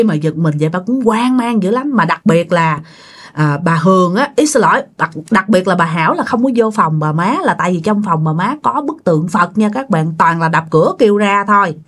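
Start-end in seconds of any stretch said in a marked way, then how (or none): none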